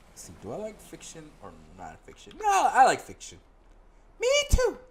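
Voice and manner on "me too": put-on bright girl guide voice, much higher; high companion voice. Companion greeting the player in town, high voice. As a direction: play the chirpy companion up high.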